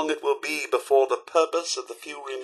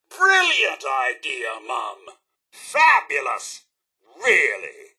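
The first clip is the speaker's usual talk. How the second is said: slow, drawn-out mock enthusiasm; sarcastic praise; 4 semitones lower, slower than usual. Fed-up companion to Mum about the adventure, sarcastic. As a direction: praise it while plainly meaning the opposite.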